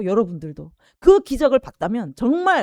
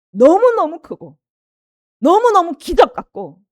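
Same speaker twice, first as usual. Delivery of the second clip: higher, louder, slow on 너무너무; emphatic awe; 7 semitones higher, 9 dB louder than usual. Real, not acted. Preacher exalting the greatness of God's grace, emphatic. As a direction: lean hard on each 너무너무.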